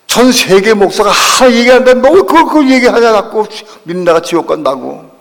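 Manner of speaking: loud, high indignant scolding, easing at the end; acted outrage, opening at full force; angry mother. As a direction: imitate a mother's heated challenge. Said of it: "막 화를 내시다".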